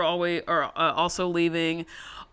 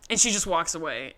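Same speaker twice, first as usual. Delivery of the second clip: ordinary talk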